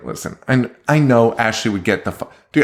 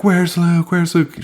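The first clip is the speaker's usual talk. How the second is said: panicked mother; 8 semitones higher, slower than usual. Retold or imitated. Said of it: "She's like".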